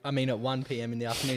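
ordinary delivery

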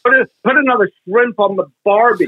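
mock an American's attempt at Australian; American imitating Australian. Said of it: "Americans find out you're Australians".

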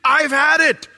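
imagined Jesus losing patience, shouting in anger; fed-up outburst. Pretend. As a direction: blow up at a wicked generation. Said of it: "That would not have shocked me".

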